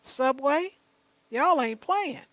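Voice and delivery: a mock gasp at the bulletproof glass; mock amazement. Host reacting to the Subway bulletproof glass, mock amazement. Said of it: "I said".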